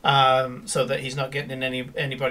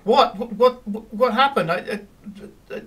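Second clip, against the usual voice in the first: startled, higher, stammering what; flustered confusion; 6 semitones higher than usual. Acted. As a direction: caught out, babble in confusion. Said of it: "Cora tucking in her shirt going".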